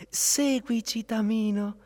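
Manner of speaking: put-on high-pitched call of kindly child spirits. A narrator's reading. Three spirit children beckon the prince, high childlike call. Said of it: "i tre buoni spiritelli fanciulli".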